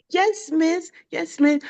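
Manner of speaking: lightly voiced child; little boy voice. Teacher imitating the boy's polite yes. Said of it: "And he goes".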